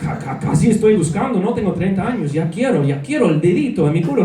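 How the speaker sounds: bold Spanish alter ego back on stage; hammed-up Spanish showman voice; Nicolas persona. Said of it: "Back to Nicolas".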